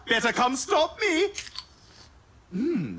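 usual speaking voice

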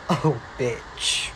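low, quiet curse under the breath; muttered curse. Acted. Student grumbling under breath at strict teacher, quiet curse. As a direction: grumble it to yourself so the teacher can't hear. Teacher gives her detention.

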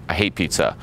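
understated falling contradiction; acted matter-of-fact dismissal; deadpan contrarian reply. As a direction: shoot her answer down coolly. His contrarian reply in example.

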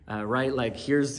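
normal talking voice

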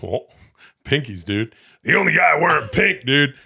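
rally through the names like a hype man; hyped-up bro. Real, not acted.

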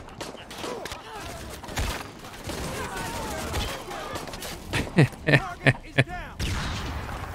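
a long, high-pitched burst of helpless laughter; shrieking laugh at a botched move. Real, not acted. Laughs at own blunder.

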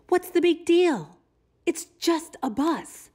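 high, wheedling bird voice; pigeon pleading. Acted. Pigeon begging the reader for one drive, high pleading. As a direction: beg and bargain like a pigeon.

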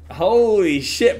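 loud, high, slow exclaim; stunned joy; excited. Real, not acted. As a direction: whoop of disbelief. Cheering when chat obeys.

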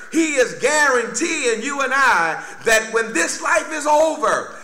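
rousing, driving sermon climax; full-force preaching. Real, not acted. Preacher promises church resurrection and life forevermore, full-force preaching. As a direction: preach in rolling phrases, punching key words.

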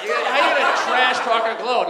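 ordinary talk

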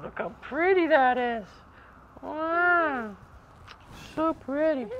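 high sing-song pet talk. Real, not acted. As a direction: coo at the bird sweetly. Admiring bird's wings.

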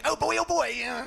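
high mock gushing; hammed-up excited fan. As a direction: gush like a fake fan, voice up high. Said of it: "They're like".

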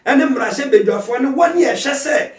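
loud, high preaching shout; preaching at full force. Acted. Pastor exhorts listeners about social media, full-force preaching. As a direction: pastor building to a fervent shout.

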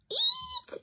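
very high eek shriek; shrill shriek. Acted. Mama Bird seeing feet on the nest edge, high eek. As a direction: shriek in fright. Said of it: "she cried".

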